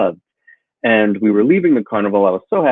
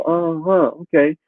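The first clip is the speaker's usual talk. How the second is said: fake polite; 6 semitones higher than usual. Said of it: "be polite and go".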